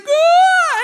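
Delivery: very high, loud scream; hammed-up terrified shriek; piercing mock scream of the exterminator; imitated yell. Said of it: "like, yell".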